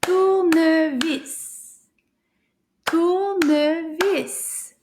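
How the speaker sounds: Tournevis slow, each syllable stretched out. Shown as a demonstration. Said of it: "Combien de syllabes?".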